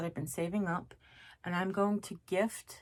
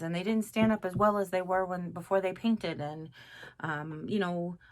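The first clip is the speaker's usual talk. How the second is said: normal talking voice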